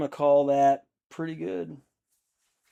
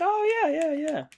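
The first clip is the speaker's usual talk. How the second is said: high mock agreement. I'm like.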